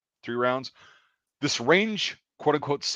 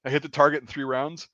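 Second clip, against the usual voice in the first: normal talking voice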